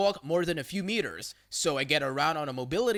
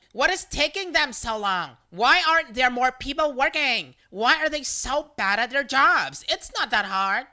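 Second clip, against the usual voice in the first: high, exasperated griping; whiny impatient customer complaint; complaining; 6 semitones higher, 4 dB louder than usual. A narrator's reading. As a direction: gripe indignantly in a raised pitch. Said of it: "complaining about the wait".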